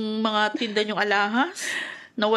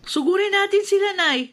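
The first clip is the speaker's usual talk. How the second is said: higher and louder, fired up; angry urging; 12 semitones higher, 5 dB louder than usual. Acted. Daughter urging mother to confront the father, loud and fired up. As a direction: push mother to go confront them.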